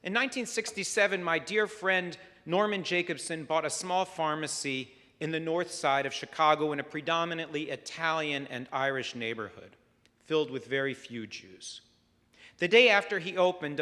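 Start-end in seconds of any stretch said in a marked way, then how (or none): none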